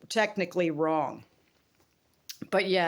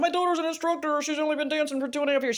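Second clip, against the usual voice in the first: higher, proud mock-brag as a mom; imitating her own bragging; 8 semitones higher, faster than usual. To the instructor, brag about daughter in a higher, proud voice. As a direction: chirp it up high like a boastful parent. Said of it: "when I said".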